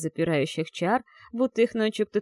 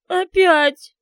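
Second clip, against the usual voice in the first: Опять wailed high and loud through sobs; Опять as a falling sob; sobbing; 11 semitones higher, 10 dB louder than usual. Acted. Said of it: "Её голос булькал от подступающих к груди рыданий".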